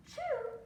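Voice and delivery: gasp, then high, loud "choo"; mimed sneeze. A narrator's reading. Storyteller as the rabbit covering his mouth, mimed high sneeze. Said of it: "But when he went".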